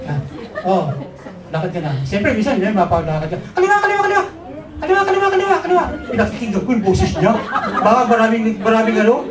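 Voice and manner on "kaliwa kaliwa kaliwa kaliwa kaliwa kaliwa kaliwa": calling out. Retold or imitated. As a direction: yell the directions across a noisy crowd. Girlfriend shouting directions at fiesta.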